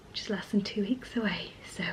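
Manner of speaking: hushed nervous whisper. Real, not acted. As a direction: whisper the worry. Worried aside about due date.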